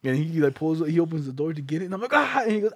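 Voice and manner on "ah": mock scare yell.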